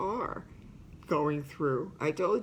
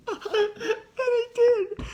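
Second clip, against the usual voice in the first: laughing tearfully; 4 dB louder, much slower than usual. Real, not acted. Laugh heard, voice breaks up.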